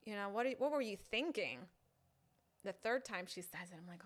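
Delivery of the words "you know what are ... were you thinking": louder, scolding disbelief; exasperated girl. Acted. Girl on a date scolding the guy, loud and exasperated. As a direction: let her scold him, loud and fed up. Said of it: "The girl says".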